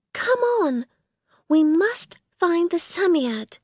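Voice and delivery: put-on high urgent girl's cry; urgent call. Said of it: "Then she cried".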